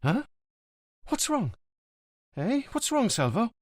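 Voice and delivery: put-on high, anxious girlfriend pressing him; suddenly agitated. A narrator's reading. Livia suspects Montalbano is in danger, agitated. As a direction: wake up and press him, worried. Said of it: "becoming more awake and agitated".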